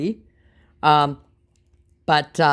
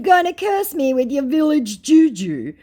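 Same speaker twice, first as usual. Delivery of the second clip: high, loud taunting question; mocking taunt; 13 semitones higher, 8 dB louder than usual. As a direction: jeer at the scammer's curse. Taunting retort to the scammer.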